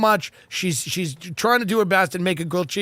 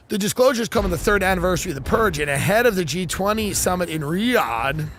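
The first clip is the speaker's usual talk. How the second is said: ordinary talk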